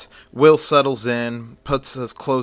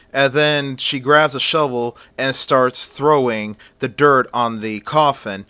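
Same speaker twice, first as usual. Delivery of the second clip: ordinary delivery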